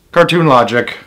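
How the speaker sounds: usual speaking voice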